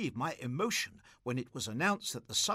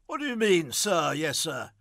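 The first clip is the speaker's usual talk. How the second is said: put-on a louder, scornful echo of the boy's words; mocking repeat; 3 semitones higher, 8 dB louder than usual. Tipsy Gussie mocks the prize-winner's reply, scornful and louder. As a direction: throw his own phrase back at him, irritated.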